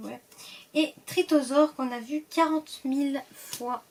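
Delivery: ordinary talk, nothing marked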